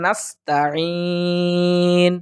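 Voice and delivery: "নাস্তাইন" slow, thin "taa", long throaty "eeen"; correct tajweed. Shown as a demonstration. Teacher modelling the word, careful tajweed. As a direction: hold the last syllable of "নাস্তাইন". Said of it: "সুতরাং এইভাবেই".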